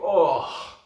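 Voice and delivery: big reaction gasp-word, acted out; imitated shocked "oh". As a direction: re-enact a gut reaction, loudly. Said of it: "those moments where you go".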